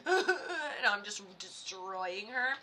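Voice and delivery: slow, laughing through the words. Real, not acted. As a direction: laugh while you say it. Laughing at herself.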